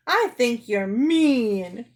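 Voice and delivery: slow, loud drawn-out wail; whining, stretched complaint. Acted. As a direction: bawl it like a hurt child. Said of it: "she wailed".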